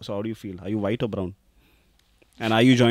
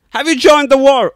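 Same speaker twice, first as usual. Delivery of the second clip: loud, high put-on British accent; exaggerated British voice, pitched way up; 15 dB louder than usual. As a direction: boom it out in a hammed-up British accent. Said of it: "in your best British accent".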